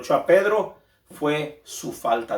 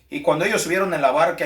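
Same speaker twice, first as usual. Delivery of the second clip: ordinary talk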